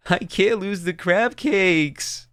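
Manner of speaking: full sobbing. Acted. Sobbing little girl pleading over her cooler, wailing in tears. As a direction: let it burst out as a child's desperate wail. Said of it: "She just started to sob".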